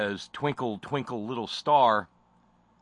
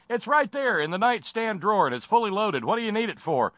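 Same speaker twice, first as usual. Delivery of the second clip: much higher and louder folksy father; hammed-up cheerful dad; 10 semitones higher, 5 dB louder than usual. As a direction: chirp it like a proud gun-loving dad. Father voiced in mock scene.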